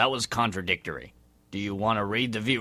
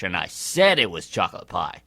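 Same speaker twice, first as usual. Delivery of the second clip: ordinary talk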